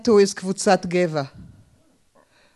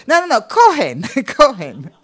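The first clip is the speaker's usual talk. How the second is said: laughing protest; 5 semitones higher, 5 dB louder than usual. Acted. Mocking the real name Cohen.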